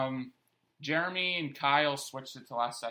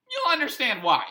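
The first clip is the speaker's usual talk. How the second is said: cheeky; 12 semitones higher, 8 dB louder than usual. Retold or imitated. Cheeky answer to the cop.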